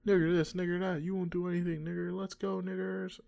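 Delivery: high-pitched retold yell, loud at first; mock yelling. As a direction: imitate a man yelling taunts, held high.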